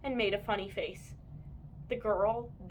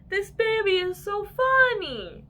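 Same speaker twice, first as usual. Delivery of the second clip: doting mother; 10 semitones higher, 7 dB louder than usual. A narrator's reading. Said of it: "She said".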